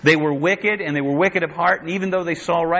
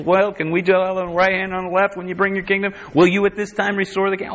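quick, higher-pitched pestering questions; eager disciples; 4 semitones higher, faster than usual. Acted. Preacher playing the disciples asking Jesus, eager and quick. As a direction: rattle off eager requests in mock voice. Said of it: "The disciples".

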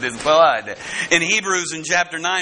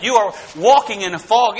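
normal talking voice

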